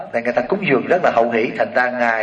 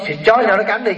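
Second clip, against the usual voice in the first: higher, scornful mock command; dismissive order; 7 semitones higher than usual. Rich lady ordering her servant to set the dogs, mock-scornful. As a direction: toss off the order like a haughty lady.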